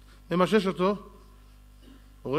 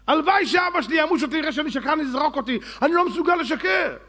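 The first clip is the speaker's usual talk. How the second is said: much higher and louder, fervent; Jacob's fervent voice; 9 semitones higher, 4 dB louder than usual. Retold or imitated. Jacob refuses to lie, high and loud. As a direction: cry out as Jacob who cannot lie.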